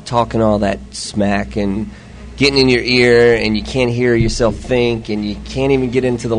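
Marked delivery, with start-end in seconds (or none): none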